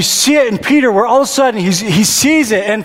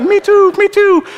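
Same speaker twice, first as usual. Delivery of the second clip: put-on much higher little one-year-old chirping; toddler's high voice; 13 semitones higher, 4 dB louder, slower than usual. One-year-old son begs to jump into the pool, high squeaky chirp. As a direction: squeak it like a tiny eager toddler. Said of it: "He's got this ridiculously high voice".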